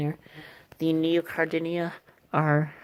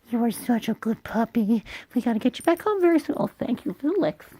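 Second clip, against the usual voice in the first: squeaky praising a dog; 8 semitones higher, 3 dB louder than usual. Real, not acted. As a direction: gush over the dog in a high, fast squeal. Praising the licking puppy.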